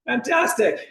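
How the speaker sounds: usual speaking voice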